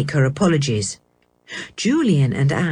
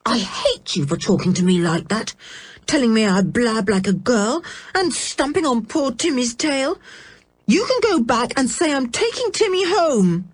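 angry; 6 semitones higher than usual. Acted. George quarrelling with Dick.

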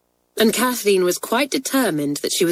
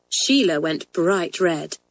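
plain reading voice

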